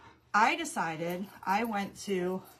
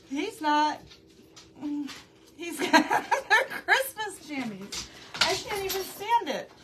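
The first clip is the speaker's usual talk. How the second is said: high, giggling, can barely get words out; helpless laughter; 10 semitones higher, 4 dB louder than usual. Real, not acted. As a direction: laugh through the words, real delight. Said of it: "I've been laughing at him".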